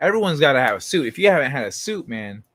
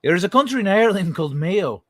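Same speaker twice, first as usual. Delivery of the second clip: put-on Irish accent; 6 semitones higher, 4 dB louder than usual. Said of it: "sorry, wrong accent".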